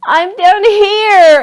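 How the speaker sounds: high, long drawn-out yell from below. A narrator's reading. Cody yells up from the hole bottom, long and high. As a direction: call up slowly at full voice. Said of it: "Cody yelled".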